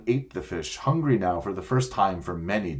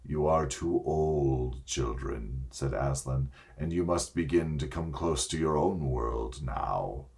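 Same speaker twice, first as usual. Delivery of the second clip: lower, grave and kindly lion voice; Aslan the great lion; 6 semitones lower, 6 dB quieter than usual. A narrator's reading. Aslan promising the children the way home, low and gentle. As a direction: speak low and calm like a wise lion king.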